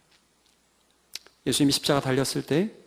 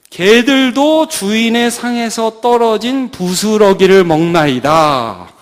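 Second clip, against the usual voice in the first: slow, drawn-out declamation; loud, slow pleading reply; solemn plea; 2 semitones higher, 8 dB louder, slower than usual. Acted. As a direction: stretch it out like a biblical supplication. Acts out the woman's plea.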